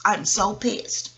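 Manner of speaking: slow, drawn-out grumble; a slow muttered complaint; slow annoyed. Real, not acted. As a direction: let each word drag in annoyance. Repeats annoyed phrase.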